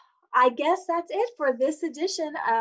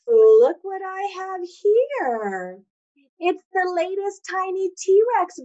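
excited; 7 semitones higher, 6 dB louder, slower than usual. Real, not acted. Showing off a favorite new book.

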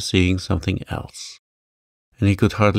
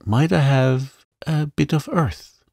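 put-on mildly quavering, higher girl's voice; a hint of tremble in the ask; understated quaver; 7 semitones higher than usual. A narrator's reading. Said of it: "quavered Mary".

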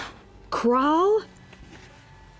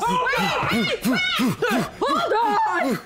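higher, laughing, slowed; laughing plea; 10 semitones higher, much slower than usual. Real, not acted. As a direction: beg them to stop, laughing. Laughing, stopping an attack.

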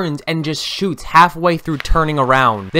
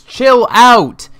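angry shout; 10 semitones higher, 6 dB louder than usual. Real, not acted. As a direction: yell it high and loud. Yelling at enemy grenades.

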